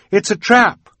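high, loud cry of warning; urgent cry. A narrator's reading. Violet warning Esme away from the pit, urgent cry. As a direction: shout the danger out. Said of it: "she cried".

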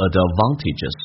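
British "advantages" with broad "ah" in the second syllable; British-accented "advantages". Shown as a demonstration. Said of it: "只是英式口音".

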